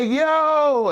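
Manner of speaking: loud, very high drawn-out yo; astonished call-out. Retold or imitated. Gym-goers seeing him drenched in layers, astonished.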